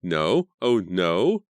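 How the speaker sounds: loud, slow drawn-out scream; horrified drawn-out scream, loud. Acted. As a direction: wail no in horror. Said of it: "Carrie screamed".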